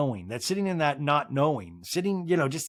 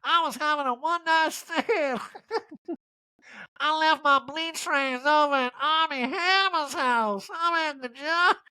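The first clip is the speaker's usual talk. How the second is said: very high, slow, breaking into laughs; Erica impression, laughing; 12 semitones higher, slower than usual. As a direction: stretch it out through giggles. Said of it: "riffing the Erica voice".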